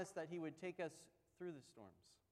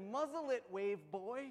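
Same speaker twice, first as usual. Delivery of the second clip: playful taunt; 3 semitones higher, 5 dB louder than usual. Retold or imitated. Jesus mocks sea god.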